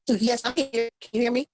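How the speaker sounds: calling out to be heard on a bad connection; shouting on bad phone line. Real, not acted. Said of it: "it's rough".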